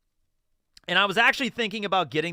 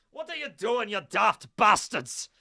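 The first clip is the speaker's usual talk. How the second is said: put-on a rough stab at a Scottish accent, slow on the insult; 6 semitones higher, 4 dB louder, slower than usual. Viewer's kilt on, a put-on Scottish scold. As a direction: play a gruff Scotsman scolding, slower at the end. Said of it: "that's as best as I got in terms of an accent".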